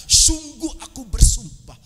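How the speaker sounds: high, loud, slow declaration; emphatic oath. Acted. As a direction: swear it with ringing, stretched emphasis. Voices God's emphatic oath. (bersumpah dengan lantang dan tegas)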